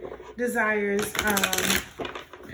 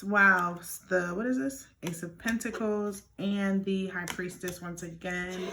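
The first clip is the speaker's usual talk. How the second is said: ordinary talk, nothing marked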